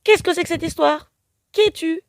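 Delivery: high, loud challenging question; indignant demand. A narrator's reading. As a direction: demand answers from the stranger. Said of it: "demande-t-elle à l'inconnu".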